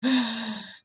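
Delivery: acted winded puff; big exhausted sigh; out-of-breath exhale. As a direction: heave out a breath after running. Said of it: "I'm out of breath. I'm like".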